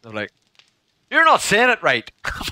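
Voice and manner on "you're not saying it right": raised-pitch mock complaint; hammed-up correction; retold objecting pupils. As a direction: object like a wound-up schoolkid.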